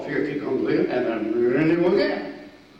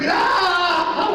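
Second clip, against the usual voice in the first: a loud, laughing "Ah!" shout, much higher; imitated stab yell; 6 dB louder than usual. Teaching bayonet drill, imitates the enemy's yell with a laughing shout. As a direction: belt out a stabbing yell while laughing. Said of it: "he goes".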